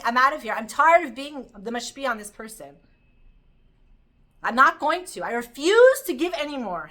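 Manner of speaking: loud, emphatic mock walkout; acted fed-up refusal. A tired giver refuses to give anymore, fed up. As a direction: play someone fed up, digging in loudly. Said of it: "The moon is saying".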